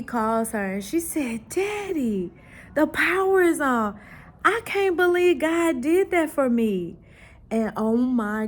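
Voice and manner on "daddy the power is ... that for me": put-on high, louder, excited child voice; amazed little girl. Daughter tells her dad on the phone, high excited child voice. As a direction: let the girl's wonder lift the pitch.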